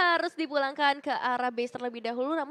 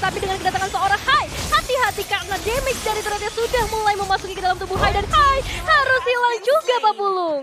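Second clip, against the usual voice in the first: loud and high hype call, rising; excited esports caster shout; 6 semitones higher, 7 dB louder than usual. Real, not acted. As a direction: shout the play as it happens. Hype call of a team fight.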